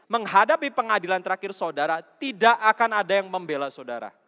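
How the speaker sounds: loud, high, driving sermon voice; full-force preaching. Acted. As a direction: preach it hard to the congregation. (khotbah berapi-api dengan suara keras)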